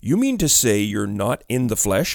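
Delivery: plain reading voice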